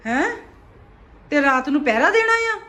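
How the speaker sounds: loud exasperated scolding; dramatic loud aunty. Real, not acted. Mother loudly scolding sleepy son.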